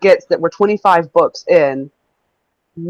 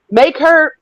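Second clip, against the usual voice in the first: raised reading-out voice; all caps shouting; 6 semitones higher, 3 dB louder than usual. Retold or imitated. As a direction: read it like it's in capitals. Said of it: "this is all in caps".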